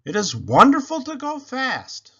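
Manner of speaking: high, loud thrilled exclamation; delighted. A narrator's reading. Baby revealing herself from the back seat, delighted. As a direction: gush with glee.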